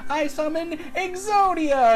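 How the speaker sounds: high, slow, grand announcement; mock summon. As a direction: proclaim the summon for a duel.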